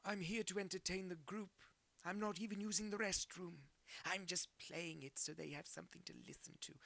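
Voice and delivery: high put-on character voice; chirpy pretend entertainer; silly high voice. Imagined bathroom movie player voice.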